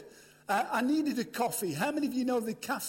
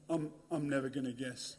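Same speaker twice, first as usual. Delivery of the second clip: put-on low, soft, deadpan teenage echo; bored kid; 11 semitones lower, 7 dB quieter than usual. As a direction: parrot him back with zero interest. Boy echoes him bored.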